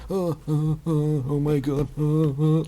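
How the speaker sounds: high, silly groans and oh-my-gods; mock Wesker moaning. Vlogger imitates Wesker crawling on the floor, silly high moaning. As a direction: moan stupidly in a high put-on voice.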